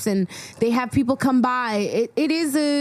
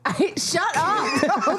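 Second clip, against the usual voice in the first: laughing; 5 semitones higher, slower than usual. Real, not acted. Laughing at the joke.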